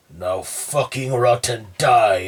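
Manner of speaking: loud, snarling demon; sudden loud demon curse. A narrator's reading. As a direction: spit it out as the demon. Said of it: "as his voice changes again".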